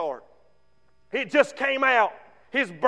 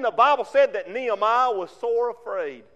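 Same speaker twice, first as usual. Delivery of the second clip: ordinary talk, nothing marked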